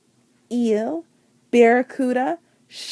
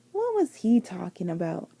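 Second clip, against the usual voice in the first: plain reading voice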